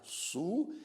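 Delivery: "Superamigo" said as su-pe-ra-mi-go; split into syllables. Shown as a demonstration. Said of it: "divisão silábica". ("Superamigo" dividido em sílabas)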